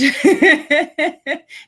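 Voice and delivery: loud high burst of laughter; real hearty laugh; big laugh. Real, not acted. Laughing at own joke.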